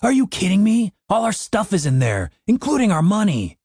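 high-pitched, exasperated outburst; shouting in disbelief. A narrator's reading. Dave tells Cooper what was left inside, exasperated shout. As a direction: protest with the pitch leaping up. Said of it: "Dave shouted".

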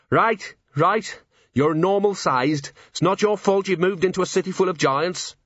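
man humours the gnome, sarcastic; sarcastic agreement. A narrator's reading.

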